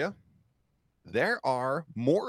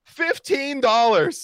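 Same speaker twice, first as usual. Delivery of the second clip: high, loud and drawn out; excited announcement; 12 semitones higher, 10 dB louder, slower than usual. Real, not acted. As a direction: call out the donation slowly. Donation announced, popcorn penalty.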